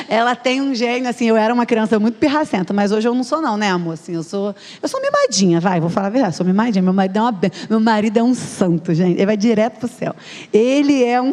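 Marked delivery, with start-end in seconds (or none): 4.8-5.6 high-pitched playful admission; playful confession. Pretend. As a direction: own up in a high, spoiled little lilt. Playfully admits being spoiled.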